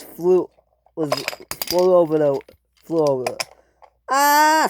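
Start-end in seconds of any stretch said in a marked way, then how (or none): 4.1-4.7 high, loud cry of alarm; scream. Acted. Passengers in the sinking coach, high scream. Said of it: "screamed the passengers".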